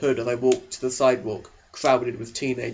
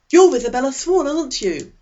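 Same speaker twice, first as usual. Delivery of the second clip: put-on higher, louder eager teen boy voice; eager Eric; 11 semitones higher, 6 dB louder than usual. Eric leaning over to the new girl, eager and high. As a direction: pitch him up high and keen, a chess-club type.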